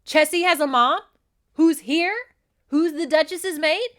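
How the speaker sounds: loud, high-pitched incredulous questions; hammed-up shocked outburst; freaking out. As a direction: act out a man panicking at shocking news. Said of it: "as he's freaking out".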